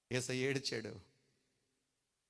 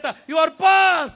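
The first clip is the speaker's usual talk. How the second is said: shout; 10 semitones higher, 14 dB louder, much slower than usual. Retold or imitated. God's verdict quoted, shouted.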